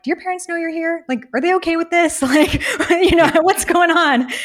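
high, fast, disbelieving quote with laughs; incredulous questioning. Retold or imitated. To travelers in Bali, asking in high incredulous disbelief. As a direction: act stunned at what you're hearing. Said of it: "I'm like".

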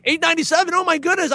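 mock price shock. Young marketer eyeing a cheap book, mock dismay. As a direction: balk at a tiny price. Retelling past worry over a book's price.

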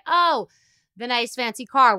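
high, loud, breezy excuse-making; mock justifying. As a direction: toss off the excuse like it's obvious. Voicing parent justifying spending.

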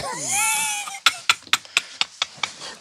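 a sudden shrieking burst of laughter; high shrieking laugh. Real, not acted. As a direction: let out a high squealing laugh. Laughing at fire gel.

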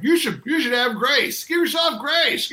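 preachy pharmacist. Retold or imitated. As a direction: scold a patient into giving themselves grace. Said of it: "I'm like".

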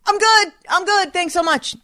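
high, forced bright reassurance; fake cheerful. Through the bathroom door to his mother, forced cheer. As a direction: pretend everything is fine. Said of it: "I'm like".

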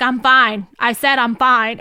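stubborn snap. Retold or imitated. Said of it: "he was like".

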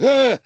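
high, loud "Ah!" of fright; sudden high shriek at a fright; startled yelp. Retold or imitated. Man retelling a rat darting past, startled yelp.

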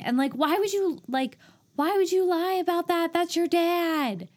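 can't believe she'd lie to her dad; exasperated high-pitched disbelief; incredulous. Real, not acted. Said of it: "incredulous outburst".

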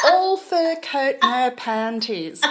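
high, slow, badly imitated British regional accent. Repeating the cooking show woman's saying, exaggerated UK accent. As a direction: ham up a poor regional UK accent, pitched high. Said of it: "I will try and do the accent".

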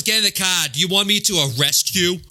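hammed-up bad Boston accent; Martin Sheen. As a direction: imitate a muddled Boston cop accent. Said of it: "what the fuck kind of accent is this".

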